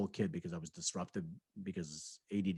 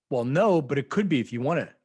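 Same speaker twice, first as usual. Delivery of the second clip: sarcastic teacher threat; 4 semitones higher, 8 dB louder than usual. Retold or imitated. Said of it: "And he said".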